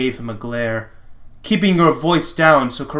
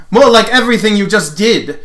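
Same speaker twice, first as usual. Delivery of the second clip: higher, louder sneering retort; haughty prince; 7 semitones higher, 7 dB louder than usual. Acted. Lotor sneering at the paladin, higher and louder. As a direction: jab back like a smug prince.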